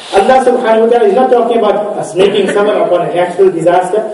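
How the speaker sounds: normal talking voice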